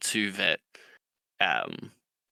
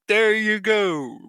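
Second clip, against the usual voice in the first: loud, high, drawn-out mock praise; drill sergeant; 11 semitones higher, 6 dB louder, slower than usual. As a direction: stretch it out like a sergeant to a slow recruit. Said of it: "the drill sergeant's like".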